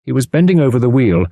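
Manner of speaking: normal talking voice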